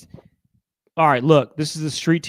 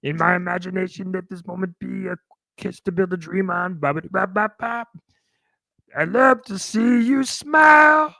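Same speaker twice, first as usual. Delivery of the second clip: [name] voice; 5 semitones higher than usual. Retold or imitated. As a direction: croon it like [name]. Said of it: "sounds a little bit like this".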